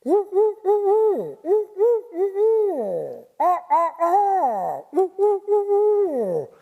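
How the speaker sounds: very high, loud whooping hoots and cries; loud barred owl calls. Shown as a demonstration. Imitating a barred owl calling in the woods, loud high whoops. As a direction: call out like a wild barred owl. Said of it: "and you hear".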